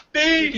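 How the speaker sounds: very high, sharp sound-effect "Bing!"; ping it out like a cartoon blade strike. Sound of the machete blow.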